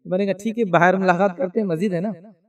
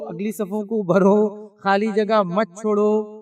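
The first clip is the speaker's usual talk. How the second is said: slow announcement; much slower than usual. Retold or imitated. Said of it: "اعلان ہوتا ہے".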